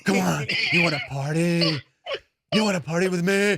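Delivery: wild movie-star party taunt; Nicolas Cage. Retold or imitated. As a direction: mimic a crazed, demonic party animal. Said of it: "talking like Nicolas Cage".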